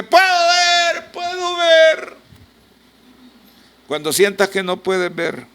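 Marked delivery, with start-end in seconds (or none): 0.0-2.2 very high, slow, ecstatic "Puedo ver"; joyful cry. Retold or imitated. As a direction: shout of wonder at seeing again. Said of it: "empieza a gritar".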